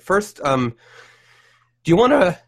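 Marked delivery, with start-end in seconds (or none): 1.8-2.4 voice cracking. Real, not acted. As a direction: voice cracks up high mid-question. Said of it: "my voice just cracked a little bit".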